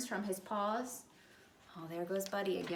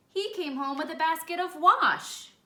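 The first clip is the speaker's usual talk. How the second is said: punchline; 8 semitones higher, 8 dB louder than usual. A narrator's reading. Buddy's mix-up punchline.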